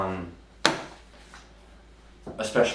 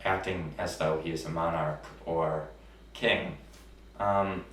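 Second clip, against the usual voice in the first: ordinary talk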